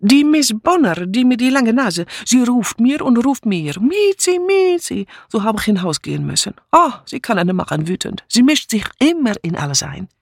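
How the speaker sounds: put-on high, whining complaint in accented German; heavy accent, high agitated pitch; exasperated foreign maid. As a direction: grumble indignantly, singing out the mocked call. Mitzi complains about Miss Bunner.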